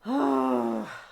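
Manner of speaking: loud, high groaning sigh; real frustrated groan; loud exasperated sigh. Real, not acted. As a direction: let out a big fed-up sigh. Exasperated at frozen computer.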